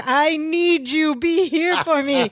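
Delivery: imitated pleading, slowly and emphatically; retold earnest plea. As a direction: stretch out each word, imitating someone pleading. Retold desperate plea to partner.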